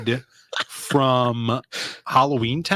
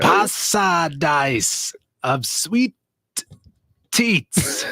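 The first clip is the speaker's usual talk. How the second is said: slow put-on Scottish reading; hammed-up Scottish voice; mock Scottish; 2 semitones higher, much slower than usual. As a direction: drag it out in a silly brogue. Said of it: "You say, like, kilt".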